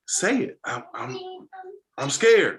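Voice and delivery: stammered, halting "I'm scared"; hesitant admission. Retold or imitated. Boy admitting fear to coach, halting and hesitant. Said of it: "And he like".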